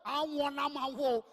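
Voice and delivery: slow, drawn-out mock swoon; hammed-up smitten girl; girl's swoon. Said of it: "She said".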